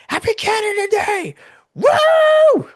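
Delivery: high, loud whooping cheer; joyful yell. Retold or imitated. Friend riding off downhill, a high loud whoop. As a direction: yell it out while speeding downhill on a bike.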